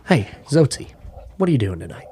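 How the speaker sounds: imitating a buddy who makes you feel important, hushed; intimate hushed voice. Ranger friend calling at night, hushed and confiding. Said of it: "he would talk to you like you were the most important person".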